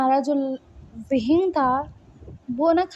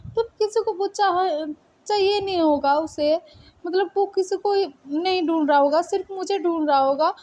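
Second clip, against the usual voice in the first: higher-pitched, insistent arguing; agitated protest; 6 semitones higher than usual. Acted. Wei Ying insists his beloved is searching only for him, agitated protest. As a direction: protest urgently, tripping over the words.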